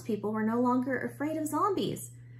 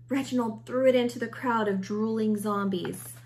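plain reading voice

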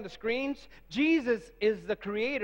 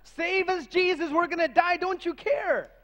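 desperate cry; 7 semitones higher than usual. Retold or imitated. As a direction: cry out for rescue in a storm. Disciples crying out in storm.

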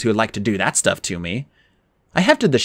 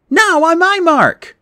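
high, slow, loud insistence; insistent Annabeth; 5 dB louder, much slower than usual. Acted. Annabeth insists they jump on her mark, high and firm. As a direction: overrule him firmly, drawing it out.

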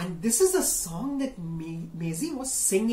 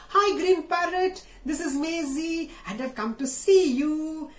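Maisie's voice; 8 semitones higher, 3 dB louder than usual. Acted. Maisie greets parrot.

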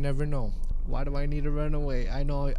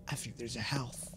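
hushed secretive whisper; 3 semitones lower, 9 dB quieter than usual. Pretend. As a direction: breathe it like a secret.

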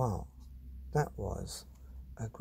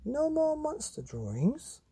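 much higher, slow hopeful question; hopeful mum; slower than usual. Acted. Mum hoping the monster drawings are over, slow and high. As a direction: stretch it out hopefully.

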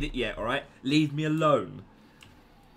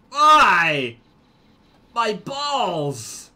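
loud, wailing howl of pain; mock agonised cry; 5 semitones higher, 11 dB louder, much slower than usual. Player blown up in game, mock agonised howl. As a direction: howl in hammed-up agony.

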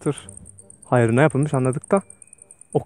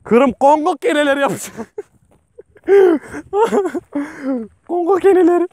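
much higher, louder half-laughing panic; panicky laughter; 11 semitones higher, 5 dB louder than usual. Real, not acted. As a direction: laugh through the fear of the ticks. Panic about ticks, laughing.

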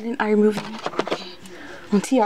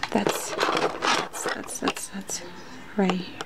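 whisper; 4 semitones lower, 6 dB quieter, much slower than usual. Real, not acted. Searching for the spot.

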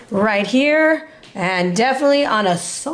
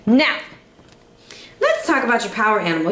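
ordinary delivery

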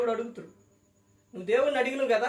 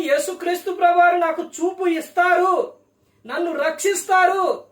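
loud, high confident exclaim; faith-filled cry; 6 semitones higher, 6 dB louder than usual. Retold or imitated. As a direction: shout out certain faith. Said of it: "ఎందుకని". (విశ్వాసంతో గట్టిగా)

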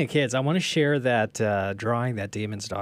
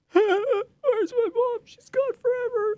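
put-on crying toddler; 6 dB louder than usual. Voicing the toddler whose mom stepped away, mock crying wail. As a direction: wail like a heartbroken little kid. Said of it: "he was like".

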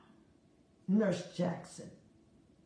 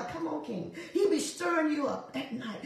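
ordinary delivery

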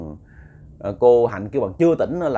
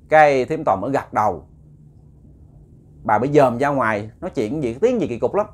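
ordinary delivery

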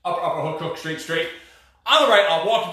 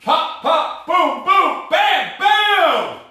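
hyped shout; 9 semitones higher, 4 dB louder, much slower than usual. Real, not acted. Hyped coach shouts final punches.

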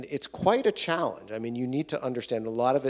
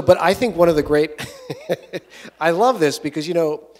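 laughing; 5 semitones higher, 9 dB louder than usual. Real, not acted. Laughing after the joke.

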